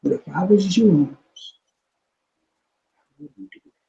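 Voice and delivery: soft, fading to a near whisper; low-voiced. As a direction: imitate a quiet warning that drops almost to nothing. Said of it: "your voice got real low".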